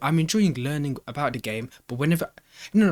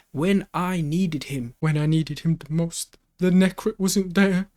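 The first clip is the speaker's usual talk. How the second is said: mock dramatic lament; 5 semitones higher, 5 dB louder, slower than usual. Mock-dramatic lament about Necrit.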